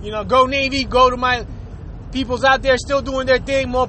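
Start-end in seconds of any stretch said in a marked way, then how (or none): none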